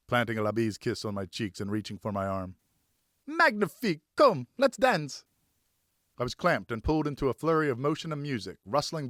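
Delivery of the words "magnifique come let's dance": high, eager call, stretched slow on the dance line; excited invitation. Acted. Ellen dragging Jacques onto the dance floor, excited. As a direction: pull him in with a bright cry.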